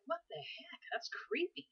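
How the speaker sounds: imitating the son's spooked whisper; creeped-out whisper. Son creeped out by footsteps on the stairs, whispering. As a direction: whisper it, like someone unnerved by footsteps.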